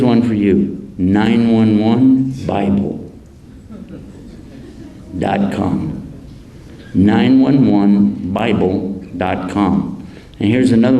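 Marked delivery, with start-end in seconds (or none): 1.0-6.1 slow, deliberate spelling out; slow dictation. Shown as a demonstration. To the audience, dictating a website slowly. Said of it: "dictating website slowly". 6.9-10.1 slow, careful repeat of the address. Shown as a demonstration. Repeating the website to the crowd, slow and careful. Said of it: "repeats the address slowly".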